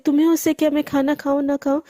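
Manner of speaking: usual speaking voice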